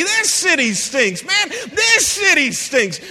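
slow, high, loud mock complaint building; griping city-hopper. Preacher mocking people who move city to city, rising complaint. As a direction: build the gripe into a high shout.

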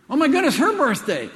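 high, loud startled exclamation; sudden realisation of a forgotten birthday; sudden alarm. Real, not acted. Speaker recalling his wife's birthday, startled and loud.

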